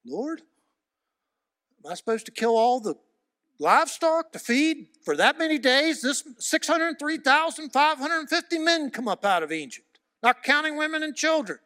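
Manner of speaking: high, loud, pleading protest; pleading Moses. Retold or imitated. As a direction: beg God in disbelief. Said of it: "And Moses, the man of God".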